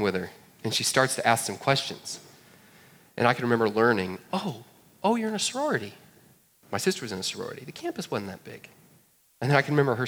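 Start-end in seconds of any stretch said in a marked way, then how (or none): 4.3-5.9 higher, softer mock girl voice; imitating the gal. Preacher mimics a college girl, higher and soft. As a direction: play the surprised college gal lightly. Gal recognizing sorority link.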